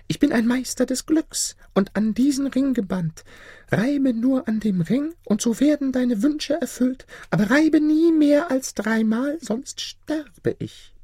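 eerie high chirp of a ring spirit. A narrator's reading. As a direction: chirp it out like a tiny beetle spirit. Spirit explains its powers.

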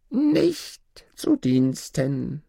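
high, drawn-out dwarf refusal. Acted. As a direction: stretch it slowly in a high dwarf voice. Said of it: "erwiderte der Zwerg". (hohe, gedehnte Zwergenstimme)

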